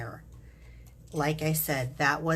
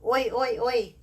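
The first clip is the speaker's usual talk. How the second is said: loud, high Aussie oi chant; Aussie cheer; 7 semitones higher, 6 dB louder than usual. Acted. Host greeting Aussie viewers, playful mock Aussie cheer. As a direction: belt out a playful Australian crowd cheer. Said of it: "I always wanna go".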